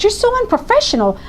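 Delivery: high, sharp, indignant cut-in; angry outburst. Retold or imitated. Mother cutting off the district attorney in court, angry high voice. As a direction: stop him short, outraged. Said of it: "so frustrated and so angry".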